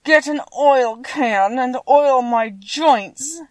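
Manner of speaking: slow, loud, high tin-man plea; mildly mournful, heavily drawn-out request; sad voice. A narrator's reading. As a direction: labour through each word like a rusted tin man. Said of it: "the sad voice in which the man spoke".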